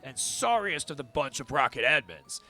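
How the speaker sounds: low, seething crime boss; super deep villain voice. Acted. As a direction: menace simmering under control. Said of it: "a super deep villain voice".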